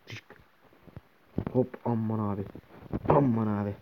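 ordinary talk, nothing marked

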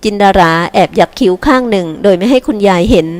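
usual speaking voice

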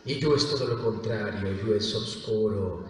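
low, soft, dark voice; Yue. Shown as a demonstration. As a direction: sink into a dark alter ego. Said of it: "la parte oscura de Yukito".

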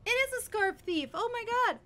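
high, loud alarm; frantic high-pitched shout; panicked shouting. Real, not acted. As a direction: cry out in panic. Creature steals her scarf.

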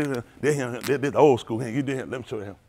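ordinary delivery

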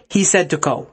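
ordinary delivery

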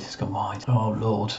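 breathed out as a whisper; whispered relief. Real, not acted. Manager relieved the shot went wide, whispered. Repeating the miss.

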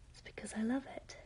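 quick whisper, half to herself; whispered repeat of her advice; whispered aside. Real, not acted. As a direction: whisper it fast.

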